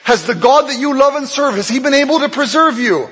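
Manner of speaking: king yells. Acted. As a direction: shout down into the den. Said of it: "and he just yells".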